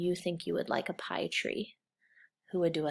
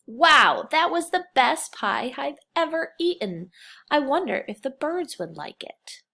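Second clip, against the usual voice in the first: higher, louder and amazed; delighted storybook reading; 7 semitones higher, 8 dB louder than usual. Acted. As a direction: sound thrilled by the best pie ever. Teacher reads picture book aloud.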